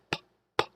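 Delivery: "P, p" as voiceless puffs without a vowel; bare P sound. Shown as a demonstration. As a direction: pop out "P, p", only the consonant. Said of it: "P makes what type of sound?".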